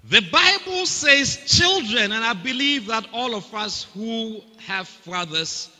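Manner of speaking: high, slow, fervent preaching; preaching climax. Real, not acted. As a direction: lift the church with a high, stretched cry.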